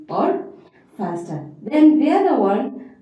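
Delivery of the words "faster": quick, softer, lower "faster". Shown as a demonstration. Teacher repeating the choice of pace, quickly. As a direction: toss it off short and quick. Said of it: "increase the pace faster".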